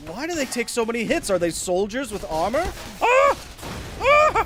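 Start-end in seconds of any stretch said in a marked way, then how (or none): 3.0-4.5 high shriek. Real, not acted. Shrieking under enemy fire.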